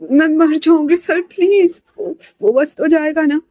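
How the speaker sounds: a desperate, weeping plea to the boss; crying plea. Acted. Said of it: "Sanaya कैसे रोते हुए". (ऊँची आवाज़ में रोते हुए विनती)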